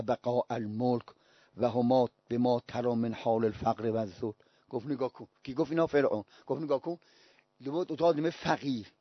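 low, soft quoting voice; hushed retelling. Retold or imitated. Preacher retelling Pharaoh's scornful remark to listeners, hushed and low. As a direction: drop right down to quote and explain quietly.

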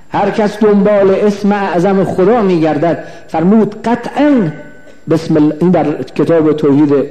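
normal talking voice

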